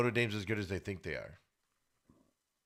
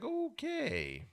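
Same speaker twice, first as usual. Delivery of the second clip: soft, high, drawn-out "okay"; quiet high-pitched hedging; careful, hesitant okay; 7 dB quieter than usual. Acted. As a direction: stretch "okay" slowly up high, holding back judgment. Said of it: "trying to choose my words carefully".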